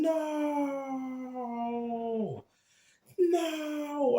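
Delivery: high anguished cry of "No!". Retold or imitated. Preacher retelling warlocks crying out, high anguished wail. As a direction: imitate grown men wailing in distress. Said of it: "them crying out".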